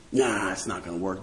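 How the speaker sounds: low, soft, offhand refusal; dismissive. Acted. Patient shrugs off the doctor's treatment, dismissive. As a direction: brush off the expert in a low voice.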